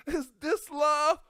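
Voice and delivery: high, loud, slow dreamy question; dazed wondering. Retold or imitated. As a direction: ask it dazed, almost starry-eyed. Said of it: "I was like".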